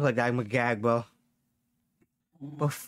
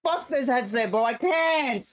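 high pained shout; mouth burning from spicy food; 9 semitones higher, slower than usual. Real, not acted. Hot sauce burning mouth.

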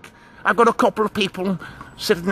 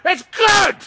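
mock shout; 10 semitones higher, slower than usual. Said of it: "people who said".

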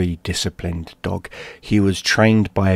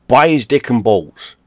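plain reading voice

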